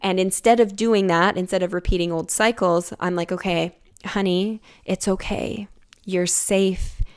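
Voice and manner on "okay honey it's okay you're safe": tender, hushed reassurance to oneself; self-soothing. Retold or imitated. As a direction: comfort a scared inner child, falling to a near-whisper. Soothing her own inner child.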